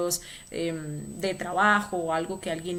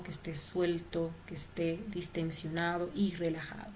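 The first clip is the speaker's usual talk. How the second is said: guided meditation. Acted. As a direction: lead them gently through the body. Leads a relaxation meditation.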